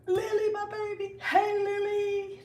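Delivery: high, drawn-out squeal of welcome; real high-pitched delighted hello; gushing greeting. Real, not acted. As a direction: sing out the name to a dear friend. Greets a friend joining the live.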